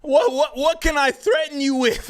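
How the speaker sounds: high-pitched incredulous questioning; exasperated disbelief. Acted. Streamer answering the man who accused him, exasperated disbelief.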